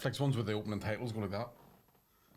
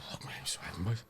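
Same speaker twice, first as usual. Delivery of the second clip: sweating tiger; 6 dB quieter than usual. Acted. Comedian playing a tiger too hot in its fur, quiet whispered grumble. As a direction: grumble softly like an overheated tiger. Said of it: "Tiger run about like".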